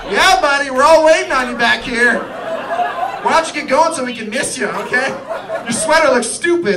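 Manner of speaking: loud, high mock-friendly jeer; sarcastic prick. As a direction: shout it with biting fake chumminess. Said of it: "look like a sarcastic prick".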